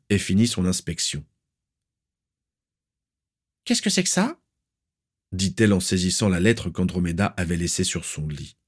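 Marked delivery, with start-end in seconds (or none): 3.6-4.4 put-on very high, sharp, demanding old aunt; shrill aunt. Aunt demanding where the niece is, shrill and stern. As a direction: pinch every word with disapproval. Walburga seizes the letter.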